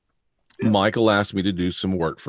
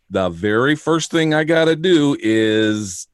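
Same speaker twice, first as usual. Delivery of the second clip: slow, higher, deliberate build-up; mock-dramatic quote; 5 semitones higher, slower than usual. Co-host imitating host's walking show, slow mock-dramatic. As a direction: draw it out like a grand announcement. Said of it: "and you go".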